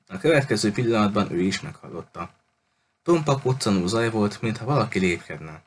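ordinary delivery

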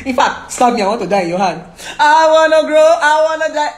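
loud, theatrical retold begging; melodramatic plea. Lover in a quarrel begging to be stabbed, melodramatic.

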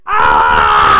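loud sustained yell; drawn-out roar of alarm; long scream. Real, not acted. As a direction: let out one long, full-force scream. Scream during game.

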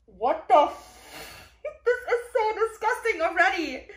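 high, loud laughing outburst; laughing disgust. Real, not acted. Reader reacting to a gross passage, laughing disgust.

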